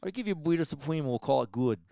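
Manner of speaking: put-on high, hammy Boston accent. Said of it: "Why do you talk like that".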